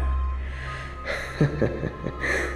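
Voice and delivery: soft, breathy mocking laugh; derisive chuckle. Queen scorning the rebels, quietly derisive chuckle. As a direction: chuckle under your breath at them.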